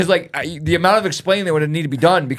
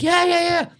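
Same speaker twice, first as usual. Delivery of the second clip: much higher, quick cheering; cheering kids; 14 semitones higher than usual. Retold or imitated. Classmates cheering a student's success, excited cheer.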